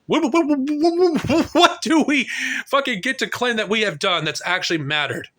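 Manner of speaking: high, incredulous repeated what; exasperated stammer. Real, not acted. Exasperated outburst at millennials.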